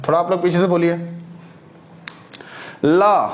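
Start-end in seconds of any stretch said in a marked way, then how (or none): 2.8-3.3 ल said alone, louder and held; demo sound. Shown as a demonstration. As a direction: model ल clearly for the class. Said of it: "ल बोल के देखिए".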